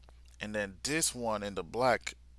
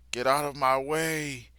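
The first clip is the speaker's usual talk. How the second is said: louder, higher angry order; 5 semitones higher, 7 dB louder than usual. Retold or imitated. Yoru drawing his bow on the villain, angry shout. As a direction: snap it at her in anger.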